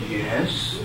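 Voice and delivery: low, tough-guy "Yes"; deepest intimidating voice. Retold or imitated. Brother answering the stranger at the window, deep and intimidating. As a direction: sound as menacing as you can. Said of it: "answered in the deepest, intimidating voice he could muster up".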